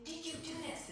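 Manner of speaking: high-pitched accusation; re-enacted indignant demand; accusing question. Accusing brother over wrecked dollhouse.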